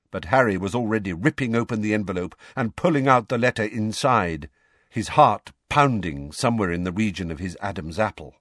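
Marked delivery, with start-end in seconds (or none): none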